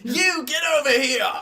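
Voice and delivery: loud, high British audition shout; British accent. Retold or imitated. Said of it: "in a British accent".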